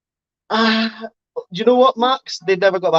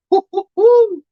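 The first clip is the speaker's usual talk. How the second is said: shocked hoots; 5 dB louder, slower than usual. Real, not acted. Shocked at ten-thousand-pound lizard price.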